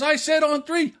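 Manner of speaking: dad insists on three, heated; heated retort. Retold or imitated. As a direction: snap back at the son. Dad's side of quarrel.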